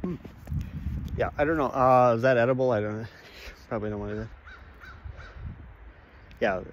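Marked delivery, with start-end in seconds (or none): none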